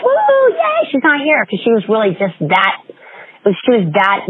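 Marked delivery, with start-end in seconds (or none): 0.1-1.4 much higher mock cheer of a group; members cheering. Class members celebrating a student's absence, high mock cheering. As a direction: squeal it like happy class members. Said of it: "even the members were like".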